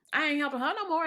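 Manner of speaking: fed up and loud, telling the viewers she's done helping; emphatic declaration. Real, not acted. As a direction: announce it loudly, done with her. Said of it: "declaring she's done".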